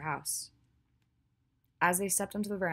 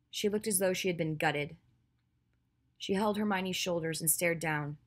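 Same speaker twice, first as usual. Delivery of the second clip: usual speaking voice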